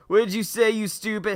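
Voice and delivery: high, loud angry snap; angry shout. Acted. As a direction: yell back in outrage. Bully shouting back.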